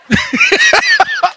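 a high-pitched delighted laugh; big laugh. Real, not acted. Laughs at own story.

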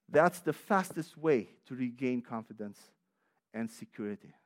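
ordinary talk, nothing marked

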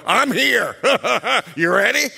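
a pushy, laughing barge-in; mock brash entrance. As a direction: play the crude husband with a chuckle. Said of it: "He doesn't barge through the door and go".

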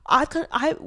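ordinary talk

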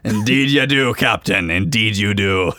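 low, slow dry reply; dry first mate. Acted. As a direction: answer dryly and deep.

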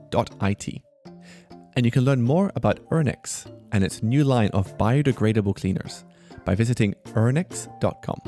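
ordinary talk, nothing marked

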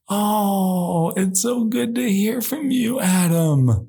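high, slow, cooing delight; drawn-out, high-pitched fond greeting; gushing welcome. Pretend. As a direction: gush over a long-lost caller. Hosts greet the caller delightedly.

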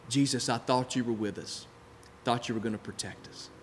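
low, soft, let-down voice; subdued hurt plea. Acted. Disciples to Jesus in the sinking boat, quiet low plea. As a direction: drop low and quiet, fading off.

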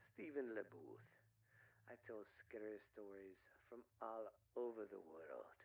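low, hushed voice meant to scare; spooky host voice. Acted. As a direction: put on a creepy ghost-story host voice. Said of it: "And I really don't talk like this".

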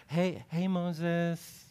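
lightly voiced chummy God sidling up; a hint of a buddy saying hi; casual chummy God. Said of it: "comes in from the side".

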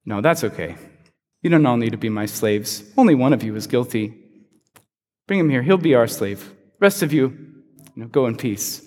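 low, calm imitation of Joseph, lightly voiced; Joseph's reply. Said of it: "and Joseph's like".

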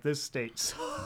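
low, soft, slow complaint; dog-tired grumble. Retold or imitated. Exhausted driver at the trip's end, dog-tired grumble. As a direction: retell it worn out, voice sunk low. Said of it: "I'm just dog tired".

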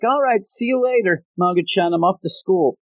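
very high imitated girlish farewell; cheery goodbye. Ruru leaving for school, cheery high goodbye. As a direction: pitch it way up, a sing-out goodbye.

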